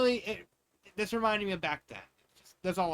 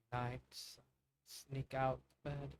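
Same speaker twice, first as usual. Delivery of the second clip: hushed; 3 semitones lower, 8 dB quieter, slower than usual. Acted. Sneaking out at night in story.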